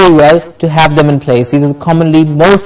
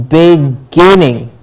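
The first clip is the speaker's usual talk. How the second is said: "beginning" slowly, weight on GIN; slow "beginning" with a strong middle syllable; stress on GIN; slower than usual. Shown as a demonstration. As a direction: say "beginning" as big, GIN-ning, stretched. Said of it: "Gin gets the stress".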